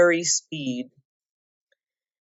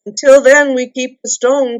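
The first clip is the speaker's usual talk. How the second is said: high, loud call; cry; 7 semitones higher, 8 dB louder than usual. A narrator's reading. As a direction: call it across to the wall. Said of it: "cried Bard".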